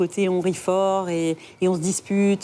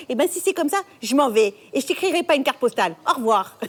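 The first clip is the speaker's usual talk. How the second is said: high, comically offended farewell; huffy mother; 6 semitones higher than usual. Acted. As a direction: play storming off in a theatrical huff. Acted mother storms off. (mère vexée qui claque un adieu, ton aigu)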